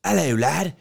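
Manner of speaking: high, exaggerated Australian warm-up; hammed-up attempt at an Australian accent; bad Australian accent. Shown as a demonstration. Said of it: "can't do my Australian accent".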